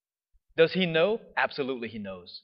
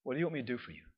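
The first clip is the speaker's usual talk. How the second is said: softer, gentle asking; soft question; 2 semitones lower, 10 dB quieter, much faster than usual. Retold or imitated. Jesus softly asking the blind man. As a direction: ask it like a quiet invitation.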